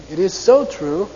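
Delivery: It is so true with so lifted and rising; rising affirmation. Shown as a demonstration. Teacher shows the believing rising tune in English. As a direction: rise on so, sincere. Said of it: "in English you go up".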